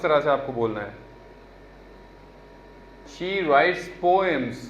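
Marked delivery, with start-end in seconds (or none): 3.1-4.6 "poems" said slowly as po-ems, two syllables; careful pronunciation. Shown as a demonstration. Teacher shows students how to pronounce poems. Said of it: "poems इस तरह से बोलते हैं पोएम्स को".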